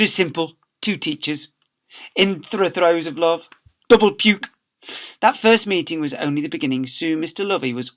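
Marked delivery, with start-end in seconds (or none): 3.9-4.5 louder, higher double puke; mock revulsion; disgusted. A narrator's reading. Disgust aside.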